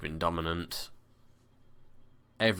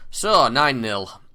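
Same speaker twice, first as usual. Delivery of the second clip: gleeful; 7 semitones higher, 11 dB louder, much slower than usual. Real, not acted. Rout complete.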